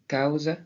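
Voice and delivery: cause said as Gascon "kawze"; "cause" with a gliding a-ou vowel; Gascon pronunciation. Shown as a demonstration. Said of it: "Et en gascon, de la même façon".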